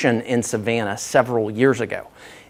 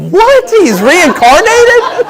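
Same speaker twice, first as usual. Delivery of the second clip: high and loud, imitating the crowd's disbelief; mock audience shock; 7 dB louder, much slower than usual. Mimicking the audience thinking Olympian Owens came back, mock shocked. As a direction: play the crowd gasping at a ghost. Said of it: "Y'all were like".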